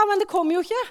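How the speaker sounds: ordinary talk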